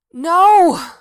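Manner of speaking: full-throated panicked no; screaming. A narrator's reading. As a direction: scream it out of a nightmare. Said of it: "Now he was screaming".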